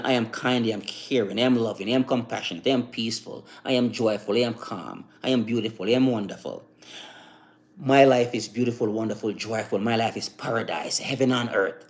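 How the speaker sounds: low, soft run of affirmations; chant-like list, each phrase falling evenly. Shown as a demonstration. Man showing how trained talk sounds, soothing affirmations. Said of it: "And you start talking like this".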